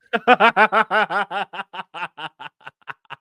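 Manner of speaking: long rolling laugh, loud at first; real laughter. Real, not acted. Teller amused at his own missed putt, laughing. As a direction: laugh hard, gradually dying away.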